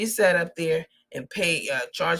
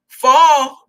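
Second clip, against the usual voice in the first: a sudden loud, high "Fall"; loud mocking call; shouted name; 7 semitones higher, 12 dB louder than usual. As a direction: bark the new name out like a punchline. Mocking her new name.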